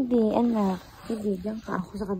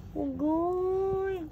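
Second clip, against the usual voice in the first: normal talking voice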